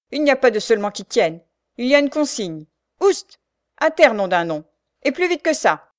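loud command with high bursts; gruff orders. A narrator's reading. Sergeant orders Marianne off the cart, gruff command. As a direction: cut off all argument, order her down loudly.

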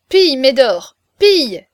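loud shouted command to a dog. A narrator's reading. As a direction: bark the attack order. Giant setting dog on him.

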